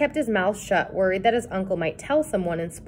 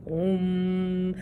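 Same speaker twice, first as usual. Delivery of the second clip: spooky moan; 4 dB quieter than usual. A narrator's reading. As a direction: moan again from the reeds. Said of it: "he started to moan".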